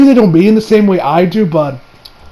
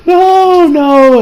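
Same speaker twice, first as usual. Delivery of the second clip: high, drawn-out mock wail; imitated anguished cry; 10 semitones higher, 3 dB louder than usual. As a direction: wail it like a felled fighter. Voices wounded friend.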